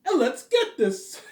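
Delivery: hammed-up Robert, slow and squeaky; Robert impression. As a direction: stretch it out like an over-the-top streamer. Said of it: "Not a bad impression".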